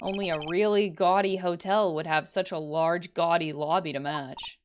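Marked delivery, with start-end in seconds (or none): none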